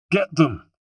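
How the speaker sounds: a shouted hint of a Genoese soldier's accent. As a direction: bark the order like a Genoese soldier. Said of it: "approximation of the voice and accent of a Genoese soldier".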